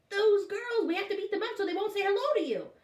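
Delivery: put-on high, fast bossy boy; bossy kid. A narrator's reading. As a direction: rattle off the plan like a bully kid. Bradley voiced plotting.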